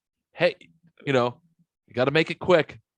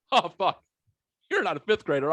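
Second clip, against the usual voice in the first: retold startled realisation, pitched up; mock surprise, higher voice; sudden startled switch; 5 semitones higher than usual. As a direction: imitate someone abruptly realising the mistake. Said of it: "he went from fragile old man".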